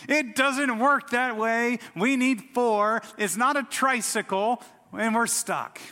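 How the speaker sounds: loud, high retold outburst of exasperation; hammed-up rage, slow and emphatic at first; enraged. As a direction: act out a husband losing it at his wife. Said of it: "I'm just kind of enraged".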